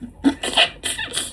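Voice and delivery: airy, high-pitched embarrassed giggle; sheepish breathy laugh; breathless high giggle. Real, not acted. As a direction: giggle it out without voice. Embarrassed laugh after quote.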